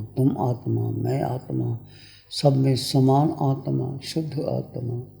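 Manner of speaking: calm, spaced-out phrases settling into a low voice; guided meditation. Real, not acted. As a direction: guide them inward, letting phrases sink and fade.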